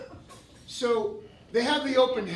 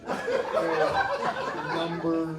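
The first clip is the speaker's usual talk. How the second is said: clueless guy; 6 semitones lower, slower than usual. Acted. Impersonating the clueless audience member.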